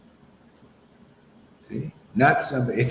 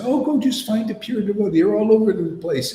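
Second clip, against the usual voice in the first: mocking quote; 9 semitones higher, 3 dB louder than usual. Mocking rival group's claim.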